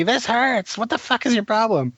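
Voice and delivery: high, whiny complaining wife voice; mock grouchy wife. Husband imitating his wife complaining while he tattoos her, high whiny voice. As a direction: whine and snap like an annoyed client in the chair. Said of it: "she's gonna be going off on me".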